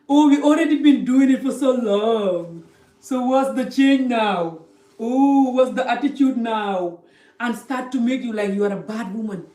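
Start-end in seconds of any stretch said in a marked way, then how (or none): 0.1-7.0 loud, drawn-out mock complaint; mock indignant protest. Men protesting when told to wait till married, mock indignant. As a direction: imitate a pushy man whining loudly, stretching the words.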